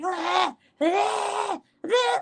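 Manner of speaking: very high imitated toddler shrieks; toddler going crazy. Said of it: "he fucking goes crazy".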